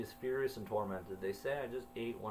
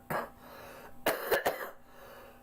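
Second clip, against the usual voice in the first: coughing fit. Real, not acted. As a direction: cough hard, breaking off the reading. Narrator's own coughing fit.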